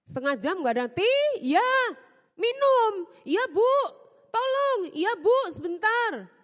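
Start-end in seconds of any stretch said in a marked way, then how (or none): 1.0-6.3 impatient calling. Retold or imitated. Employer calling, maid delaying.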